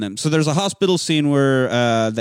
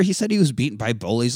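normal talking voice

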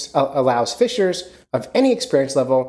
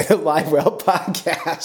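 laughing correction; 4 semitones higher, slower than usual. Real, not acted. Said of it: "no, sorry".

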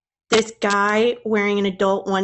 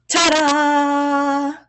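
showy ta-da; 4 semitones higher than usual. Pretend. Showy reveal.

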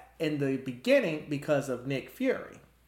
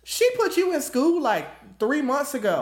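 high, loud and incredulous; incredulous mock reaction; 10 semitones higher, 5 dB louder than usual. Podcaster reacting to a mute boy suddenly talking, high-pitched disbelief.

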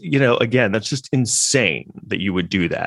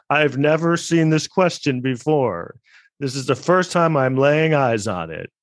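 mock-innocent higher voice, slow; 6 semitones higher than usual. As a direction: feign surprise at a rehearsed question. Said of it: "he should get an Oscar for that performance".

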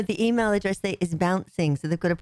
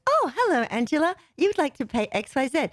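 automated system voice; 3 semitones higher than usual. Retold or imitated. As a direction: chirp like a cheerful payment bot. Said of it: "And it goes".

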